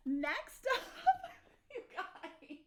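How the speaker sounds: laughing through the words, breaking into a whisper; can't hold the giggles in; cracking up. Real, not acted. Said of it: "I can't even keep straight face".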